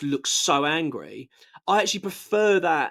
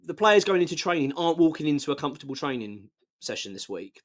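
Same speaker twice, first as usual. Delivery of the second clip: ordinary delivery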